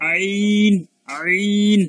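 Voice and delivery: আইন loud and drawn out from mid-throat; throaty ain. Shown as a demonstration. Teacher comparing it with ha, effortful throaty ain. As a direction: squeeze and hold আইন in the throat. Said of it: "এখন আইনটাও এরকম হবে".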